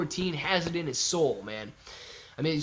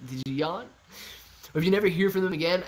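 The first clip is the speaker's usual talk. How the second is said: ordinary talk, nothing marked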